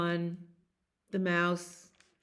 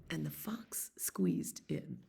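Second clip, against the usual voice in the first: whisper; 3 semitones lower, 8 dB quieter than usual. A narrator's reading. Whispered narration.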